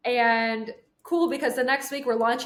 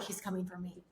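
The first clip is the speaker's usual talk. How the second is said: much softer, hushed to herself; smug whisper; 4 semitones lower, 15 dB quieter than usual. Retold or imitated. In the back seat, confiding to herself, hushed and smug. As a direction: say it under your breath like a secret.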